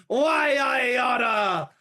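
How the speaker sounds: long, high furious shout; bellowing. Acted. Carl chasing the culprit, drawn-out high bellow. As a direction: bellow it, stretching every word. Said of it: "he bellows".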